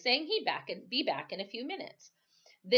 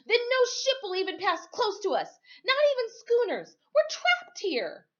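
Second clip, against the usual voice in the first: high, loud rising panic; frightened boy realising they're trapped; panicked; 6 dB louder than usual. Acted. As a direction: cry it out as hope drains. Boy panics about being trapped.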